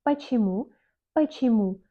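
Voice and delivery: "Почему" with the first o said as a; reduced O. Shown as a demonstration. Teacher answering why with a Russian example, reduced O. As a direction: say "Почему" as pachimu. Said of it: "on prononce comme A".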